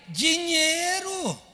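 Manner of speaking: high, loud, slow cry; preacher crying out the answer at full force; shouted answer. Real, not acted. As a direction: stretch the word out and shout it.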